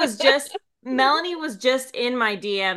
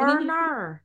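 softer put-on Australian "Arnar"; Australian accent; 4 semitones higher, 4 dB quieter than usual. Shown as a demonstration. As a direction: repeat it in exaggerated Australian. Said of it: "as good as your Australian accent".

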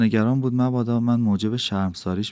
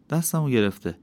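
ordinary delivery

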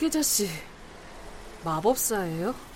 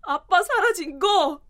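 ordinary delivery

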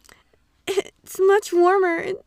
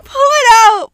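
crying protest; 11 dB louder than usual. Acted. Heroine protests in dub.

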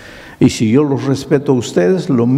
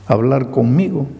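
ordinary talk

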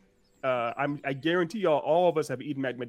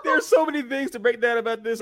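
laughing through words; 10 semitones higher, 9 dB louder than usual. Real, not acted. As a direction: laugh it out, stretching the start. Laughing high exclamation.

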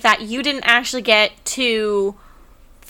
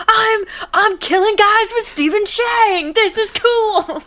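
much higher, gleeful fan squeal; excited fanboy; 10 semitones higher than usual. Retold or imitated. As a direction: relive the thrill of fighting beside a hero. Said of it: "I was like".

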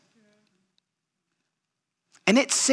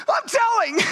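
put-on much higher childish whine, I'm telling; whiny kid tattling. Soldier mimicking his coworker's childish tattle, high whiny voice. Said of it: "he's like".

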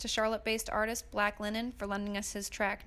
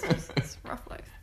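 whispered mock lament; 4 semitones lower than usual. Real, not acted. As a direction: whisper it with a wry sigh.

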